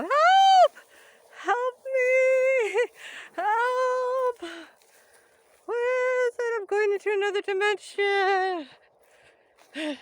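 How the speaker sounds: high, loud playful calls for help; mock cry for help. Stuck in the tall glass, mock yelling help. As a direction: pretend to be trapped and yell.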